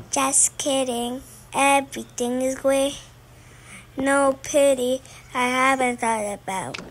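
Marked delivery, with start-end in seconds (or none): none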